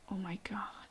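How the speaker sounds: hushed, breath-only dismay; shocked whisper. Acted. Parent hearing agents are in the hospital, shocked whisper. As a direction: whisper it as the news sinks in. Acted shock at bad news.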